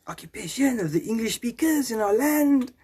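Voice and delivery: high, hammed-up French accent; mock political complaint in a high French accent. Resistance Party member on English speakers, high French accent.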